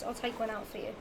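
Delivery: ordinary talk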